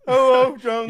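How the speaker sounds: loud, high-pitched lumbering oaf impression; big mock-dopey holler; Sloth from Goonies. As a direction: bellow it like a simple-minded movie monster. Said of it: "coming off like Sloth from Goonies".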